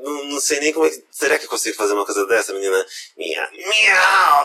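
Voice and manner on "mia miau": kitten voice. Shown as a demonstration. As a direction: meow like a cute kitten. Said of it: "fazendo voz de gatinho".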